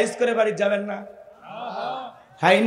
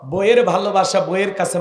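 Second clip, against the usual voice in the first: usual speaking voice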